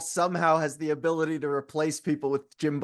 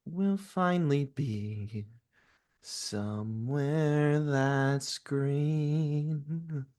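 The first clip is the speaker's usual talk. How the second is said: much softer and very slow, lost in a dream; dreamy and entranced; 5 dB quieter, much slower than usual. Acted. Entranced daughter telling her mother about the green world, dreamy and hushed. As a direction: drift through it like a lullaby.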